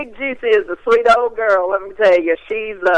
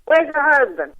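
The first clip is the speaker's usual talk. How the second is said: attempted Australian accent; 7 semitones higher than usual. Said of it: "I don't know how to do the little accent".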